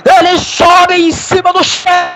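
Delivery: high, slow, stretched goal call; explosive goal commentary. Real, not acted. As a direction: savor every word of the goal. Goal replay, commentator still raving. (narração de gol empolgada)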